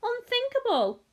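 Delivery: very high, scandalised exclamation; cried out. A narrator's reading. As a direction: reject the very idea in a high cry. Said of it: "cried Mister Wonka".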